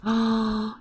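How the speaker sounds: high intake of breath; excited gasp. Real, not acted. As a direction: gasp as the big moment arrives. Sim about to age up.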